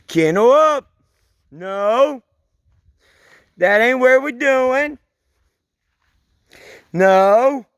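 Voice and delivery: loud high commands to dogs; calling dogs loudly. Real, not acted. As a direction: order the dogs into the kennel.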